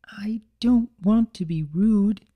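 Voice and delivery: shy mare afraid of seeming rude; Fluttershy voice. Acted.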